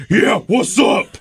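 scare off the catcaller; tough-guy reply. Retold or imitated. Intern retelling his answer to a catcaller, tough and slow. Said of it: "Why'd you say it like that".

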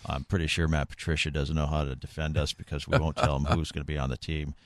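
very low, soft monotone coach; Bill Belichick. Acted. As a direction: mumble evasively, low and quiet. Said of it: "take a listen to my comments".

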